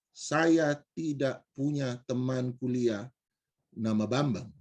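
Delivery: low and slow, deliberate; measured firm denial. Retold or imitated. Speaker correcting the stranger, firm and deliberate.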